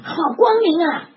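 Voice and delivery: high loud cry of wonder; 好光明啊 cried high and loud; excited shout. Retold or imitated. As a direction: shout out the brightness. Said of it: "大声喊了".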